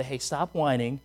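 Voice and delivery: put-on soft, low, firm talk to a whining child; calm a child down; a mild, soft parental telling-off; parent scolding gently. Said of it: "until I'd say".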